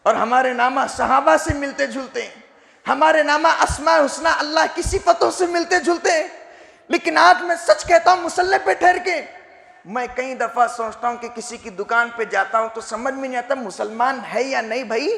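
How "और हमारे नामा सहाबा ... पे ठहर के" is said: high, urgent preacher's voice; fervent sermon delivery; impassioned preaching. Real, not acted. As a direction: confront the congregation with raised fervour. Preaches at raised force.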